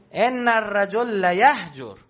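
slow, drawn-out mock shout in Arabic; half-hearted retold yell, dragged slow; imitating a shouted insult, slowly. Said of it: "داد زد،".

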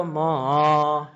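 ما dipped down, then lifted; long ما with a dip in pitch; falling-rising tone. Shown as a demonstration. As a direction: bring ما down and swing it back up. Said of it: "بیاری پایین، بعد ببری بالا".

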